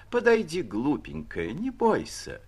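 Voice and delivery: выше, сюсюкая; барыня уговаривает Муму не бояться; ласковое уговаривание. A narrator's reading. Барыня подманивает собачку. (нежные уговоры)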